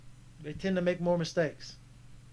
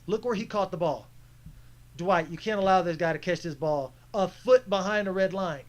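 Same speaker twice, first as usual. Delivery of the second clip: ordinary talk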